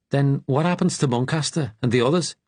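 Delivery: put-on Liverpudlian accent. A narrator's reading. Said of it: "A Liverpudlian accent".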